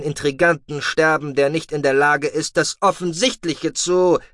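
a calm officer scolding his deputy; stern commander. Acted.

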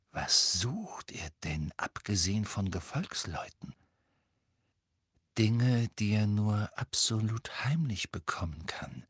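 slow, secretive half-whisper; remembered voice. Acted. Recalling another's secret words, hushed and slow. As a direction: drop into a hushed, drawn-out conspiratorial tone.

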